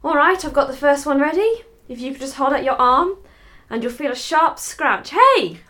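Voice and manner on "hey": sudden loud startled cry; startled yelp. Acted. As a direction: jump at the sharp scratch. Needle jab reaction.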